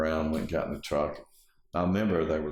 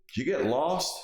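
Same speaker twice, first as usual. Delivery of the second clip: higher, pointed mock question; retold challenge; 11 semitones higher, 4 dB louder than usual. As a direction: imitate a local challenging a stranger.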